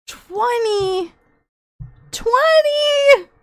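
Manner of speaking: high shrieked number in disbelief; excited shout. Real, not acted. Streamer shrieking at her viewers' gift, excited shout. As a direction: yell it out, overwhelmed. Still shocked by gifts.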